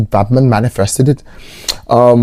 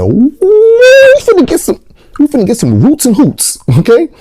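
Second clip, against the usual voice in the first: hyped shout; 4 dB louder than usual. Retold or imitated. A viewer reacting to the movie with a thrilled high whoop. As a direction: squeal it like a thrilled fan.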